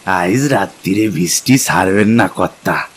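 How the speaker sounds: village porter's dialect; rustic East Bengal dialect. Acted. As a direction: speak like a simple villager.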